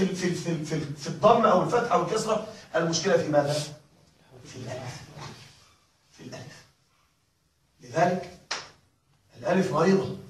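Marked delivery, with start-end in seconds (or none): 4.5-6.6 whisper. Real, not acted. Teacher reveals answer dramatically.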